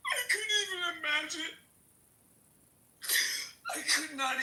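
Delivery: real crying, pitch up and fast, half laughing; words breaking through sobs. Real, not acted. Guest on a panel, overwhelmed by the family's loss, crying. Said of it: "hearing [name] in tears".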